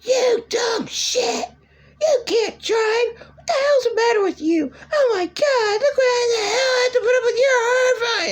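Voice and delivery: high, far-off shrieking rant near tears; screaming godmother. Retold or imitated. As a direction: shriek it like a furious old woman losing it at the driver. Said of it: "she's screaming".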